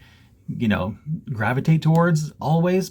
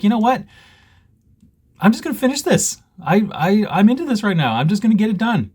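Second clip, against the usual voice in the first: high-pitched, fired-up determination; retold eager resolve; 10 semitones higher, 4 dB louder than usual. Stitcher reliving the decision to finish, excited high resolve. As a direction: pitch it up as if seized by sudden inspiration. Retells own burst of resolve.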